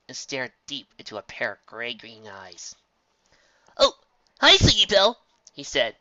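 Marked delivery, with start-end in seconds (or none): 3.8-5.2 nervous greeting. A narrator's reading. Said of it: "his mouth turning to cotton".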